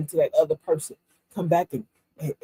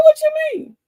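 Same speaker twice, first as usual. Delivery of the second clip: very high, loud startled question; taken aback, voice jumping up; surprised; 10 dB louder, faster than usual. Retold or imitated. Patient told not to celebrate, surprised and loud. Doctor bursts his bubble.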